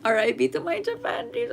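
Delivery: mock lament. Real, not acted. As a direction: lament it playfully in a high voice. Jokey lament over cancelled travel.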